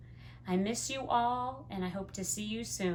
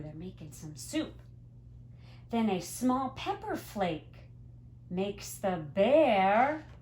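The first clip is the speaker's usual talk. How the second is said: ordinary delivery, nothing marked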